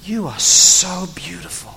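lightly voiced flirting Abraham; a hint of a crooning husband. Abraham flattering his wife on the donkey, lightly crooning. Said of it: "He turns to her and goes".

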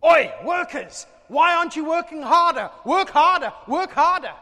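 high, loud, barking overseer yelling; bossy shout. Retold or imitated. Master on his fast day yelling at workers, loud. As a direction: drive the workers like a tyrant boss.

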